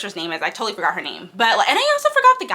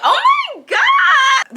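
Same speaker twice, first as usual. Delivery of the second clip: much higher, louder and slow, a swooning shriek; squealing oh my god; 4 dB louder, much slower than usual. Retold or imitated. Fangirling over the mean professor to viewers, a high squeal. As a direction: swoon out loud over him.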